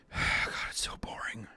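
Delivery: whispered, sighing complaint; bored whisper. Real, not acted. Viewer whispering a bored complaint about the scene. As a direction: breathe it out, fed up with the film.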